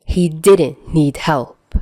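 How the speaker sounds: ordinary talk, nothing marked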